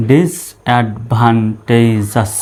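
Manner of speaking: very slow, syllable-by-syllable ডিসঅ্যাডভান্টেজাস; ডিসঅ্যাডভান্টেজাস slowly split up for learners; broken into syllables. Shown as a demonstration. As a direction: draw ডিসঅ্যাডভান্টেজাস out part by part. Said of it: "syllable অনুসারে ভেঙে ভেঙে উচ্চারণ করব".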